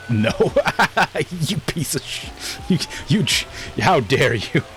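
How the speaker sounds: high-pitched laughing outrage; mock outrage. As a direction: sputter in playful, high-pitched indignation. Mock outrage at fake reverse camera.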